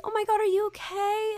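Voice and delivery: high-pitched imitation of worried onlookers; mock concern. Onlooker to a supposedly crying classmate, mock concern.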